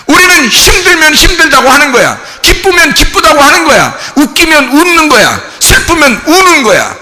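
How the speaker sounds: fervent sermon voice; emphatic preaching. Real, not acted. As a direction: drive each point home to the congregation. Preaches with force.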